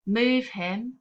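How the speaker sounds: "move him" with "move" hit hard and "him" light; trochee: "move" strong, "him" weak; stress on move. Shown as a demonstration. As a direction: punch "move", let "him" fall away. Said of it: "where the emphasis is on the first of the two".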